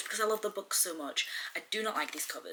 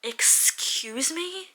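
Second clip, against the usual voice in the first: drawn-out, high, breathy disbelief; scandalised and incredulous; affronted; 12 semitones higher, 4 dB louder, much slower than usual. Real, not acted. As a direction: stretch it into an offended question. Affronted reaction to the book.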